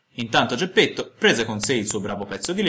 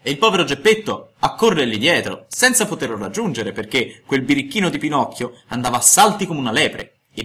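usual speaking voice

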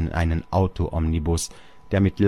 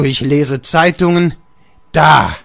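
higher, louder scornful bark; angry shout; 9 semitones higher, 10 dB louder than usual. Acted. Father throws the paper at Georg, shouting. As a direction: fling the word with the paper.

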